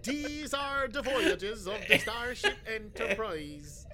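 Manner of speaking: slow, grand captain's address; hammed-up Picard opening speech. Mocking the captain's daily intercom speech, slow and grand. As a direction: stretch it out like a pompous broadcast.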